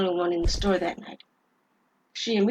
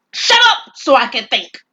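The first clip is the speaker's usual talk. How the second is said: loud, higher snap; angry robber; 5 semitones higher, 12 dB louder than usual. Acted. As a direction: cut her off sharply. Robber snaps at clerk.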